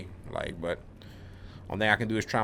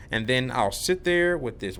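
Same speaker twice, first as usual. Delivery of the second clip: normal talking voice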